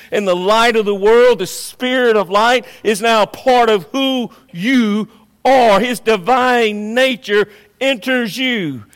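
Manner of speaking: loud, high, slowed preaching; fiery pulpit declaration; full-force preaching. Acted. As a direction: thunder it out to the congregation. Preaching rise.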